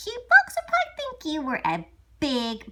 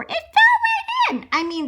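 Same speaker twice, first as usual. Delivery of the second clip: ordinary delivery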